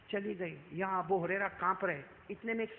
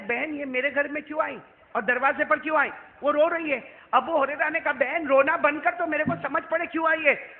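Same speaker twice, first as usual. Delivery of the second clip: urgent questioning; 8 semitones higher, 8 dB louder than usual. Acted. Abu Huraira questions weeping woman.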